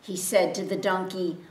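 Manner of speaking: plain reading voice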